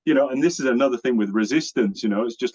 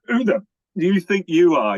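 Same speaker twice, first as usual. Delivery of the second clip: indignant retort; 9 semitones higher, 7 dB louder than usual. Retold or imitated. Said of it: "they go like".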